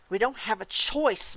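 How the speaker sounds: a slow, hard snap; snapped. Acted. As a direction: cut him off sharply. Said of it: "Michael snapped".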